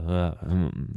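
plain reading voice

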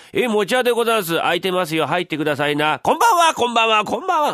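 high, loud tradesman greetings; fake mochi-maker. Husband pretends to be the mochi-maker at his own door, loud high calls. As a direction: sing out like a street trader. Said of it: "餅屋のふりして声かけるから".

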